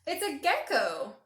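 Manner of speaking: high, loud storybook discovery; big animated gasp of a reveal; surprised reveal. A narrator's reading. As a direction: exclaim it as a sudden find.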